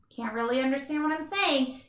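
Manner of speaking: usual speaking voice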